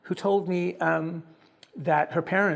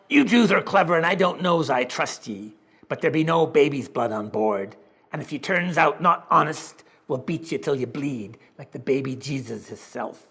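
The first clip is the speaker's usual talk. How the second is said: gruff sailor. Acted. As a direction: bellow it like a grinning old sailor. Sailor speaks in story.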